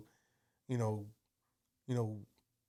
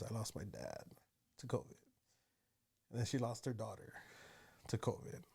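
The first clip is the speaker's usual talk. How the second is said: retold tearful mom; 9 dB quieter than usual. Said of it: "she was in tears".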